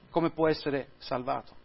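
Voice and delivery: preacher quietly repeating the question to let it land; quiet echo. Real, not acted. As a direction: let it sink in, almost to yourself.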